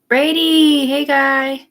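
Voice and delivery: high, loud hail; calling out. Real, not acted. Hailing Brady by name, calling out. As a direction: call to him, high and loud. Calls out to a man.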